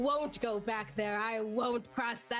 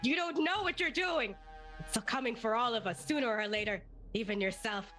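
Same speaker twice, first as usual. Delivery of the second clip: much higher, frantic desperate villain; desperate pleading; 12 semitones higher than usual. Acted. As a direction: shriek it like a cornered villain. Dracula being mummified, desperate.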